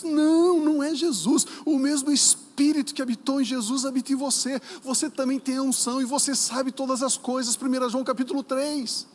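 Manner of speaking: loud, high-pitched pulpit rebuttal; forceful preaching. Real, not acted. Preacher corrects the congregation on who they are, forceful preaching.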